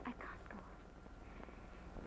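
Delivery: whispered, very slow, near tears; barely able to speak; breaking down. Real, not acted. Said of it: "It's all so shameful to tell".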